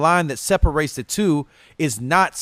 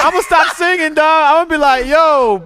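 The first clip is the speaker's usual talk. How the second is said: excited; 12 semitones higher, 7 dB louder than usual. Pretend. Hyped mock complaint.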